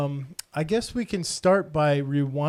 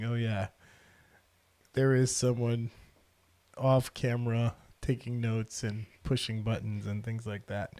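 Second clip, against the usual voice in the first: mock aside; 6 semitones lower, 6 dB quieter than usual. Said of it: "I need to do a better job of saying".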